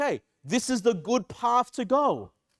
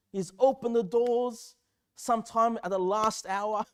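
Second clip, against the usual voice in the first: normal talking voice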